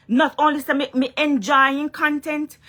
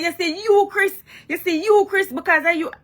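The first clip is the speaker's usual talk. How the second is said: higher mock scolding at Chris; mock cussing; 7 semitones higher than usual. Pretending to cuss out Chris on a live video. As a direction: play-act cussing out a friend. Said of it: "me pretend like me a cuss him".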